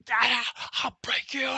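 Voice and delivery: loud, high furious threat; enraged drill instructor threatening the recruit; flipping out mad. Retold or imitated. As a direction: snap like you're about to lose it. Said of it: "flipping out mad".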